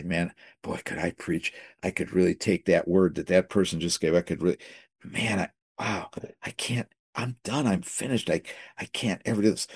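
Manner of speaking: soft, regretful murmur to himself; hushed lament. Retold or imitated. Sitting in church wishing to preach, hushed regret. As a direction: sighs it out under his breath.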